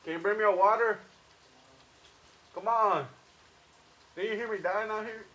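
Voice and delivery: shouting to someone outside; calling out loud. Real, not acted. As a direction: call out loud. Calling out to brother.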